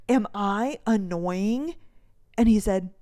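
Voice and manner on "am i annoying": over-enunciated slow question; slow, drawn out. Retold or imitated. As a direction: stretch each word out slowly. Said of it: "I said it again".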